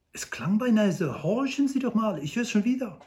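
excited Hobble Frank. Acted. Frank urges Old Shatterhand to listen, excited high voice. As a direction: urge him to listen, excited.

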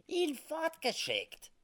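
put-on high, slow innocent old trapper. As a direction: play it innocent in a squeaky voice. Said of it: "sagte Sam harmlos".

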